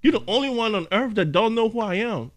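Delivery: high, disbelieving diva; indignant celebrity. Retold or imitated. Kim K insisting to the bouncer, high indignant diva.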